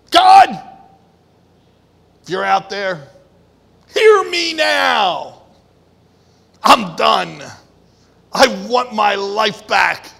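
acted loud prayer; desperate cry to God. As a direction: cry out to heaven, pleading.

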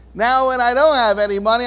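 ordinary delivery

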